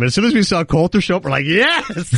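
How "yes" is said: high, laughing cheer; gleeful cheer. Retold or imitated. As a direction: cheer like a delighted comic, pitch jumping up. Comics' glee as Coulter arrives.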